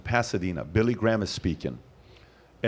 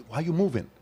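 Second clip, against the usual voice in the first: high-pitched, urgent shushing; irritated audience hushing; 7 semitones higher than usual. Acted. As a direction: act out listeners straining to hear, annoyed. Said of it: "It was like this".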